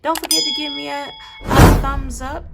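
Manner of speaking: loud, high hype call; excited shout. Real, not acted. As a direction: pump up the audience loudly. Hyping viewers to like video.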